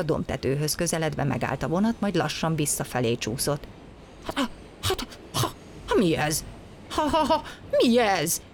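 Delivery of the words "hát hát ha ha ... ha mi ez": startled stammer breaking into a high call; splutter, then cry out in puzzlement; astonished calling. A narrator's reading. Engine driver sees the train slipping back, astonished calling. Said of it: "kiabált a mozdonyvezető".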